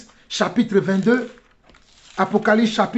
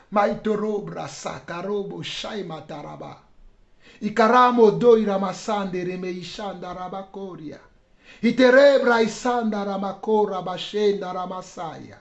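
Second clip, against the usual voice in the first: lowered, rhythmic prayer chant; softer prayer in tongues; praying in tongues; 3 dB quieter than usual. Real, not acted. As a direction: murmur a stream of ecstatic syllables. Prays in tongues.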